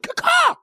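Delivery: very high "caw-caw" squawk; bird-call catchphrase. Shown as a demonstration. Radio host sounding a signature call, high bird squawk. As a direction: let out a high-pitched crow call. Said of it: "my keyword to call it".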